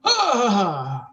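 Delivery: loud, high "ah" that slides down; drawn-out cry. Acted. Player pushes through the tight doorway, loud falling cry.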